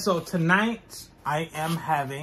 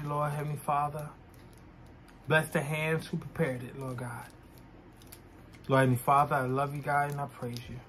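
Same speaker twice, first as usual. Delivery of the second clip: soft, earnest spoken prayer; 3 dB quieter than usual. Real, not acted. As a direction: pray quietly and sincerely. Says grace before eating.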